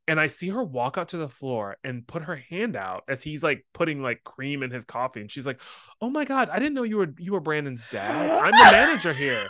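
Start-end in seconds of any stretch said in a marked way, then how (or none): 6.0-9.4 imitating a high, effusive greeting; gushing introduction. Manager meets employee's supposed dad, gushing. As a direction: gush upward, getting louder toward the end.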